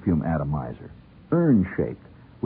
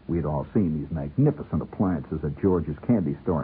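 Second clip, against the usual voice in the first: plain reading voice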